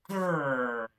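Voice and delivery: loud hammed-up kitten growl; mock growl. A narrator's reading. Splat trying to scare his friends, mock growl. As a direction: growl to scare them, playfully.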